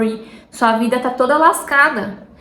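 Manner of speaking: ordinary delivery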